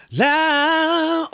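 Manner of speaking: high la, air held back. Shown as a demonstration. Coach showing breath held back, high la. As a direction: sing la while forcing the air back. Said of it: "So you're going".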